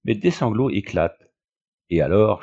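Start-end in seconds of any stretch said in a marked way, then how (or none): none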